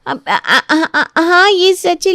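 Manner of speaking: flustered, stuttering guilt; nervous stammer. Acted. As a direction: stumble into a cornered confession. Caught out, stammers a confession.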